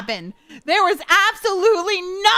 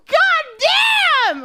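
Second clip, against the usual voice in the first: excited exclamation; 10 semitones higher, 4 dB louder than usual. Real, not acted. Reacting to game scene.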